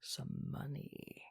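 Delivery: slow, breathy conspiratorial murmur; hushed aside. Pretend. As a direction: drop to a near whisper on the last words. Whispered aside about saving money.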